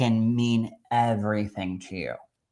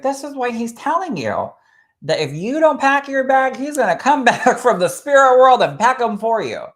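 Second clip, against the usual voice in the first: high, pushy urging voice raised up; urging quote; 11 semitones higher, 5 dB louder than usual. Retold or imitated. Medium telling a widow to take the trip, high and urgent. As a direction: urge her hard to go on the trip, pitched way up. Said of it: "And I was like".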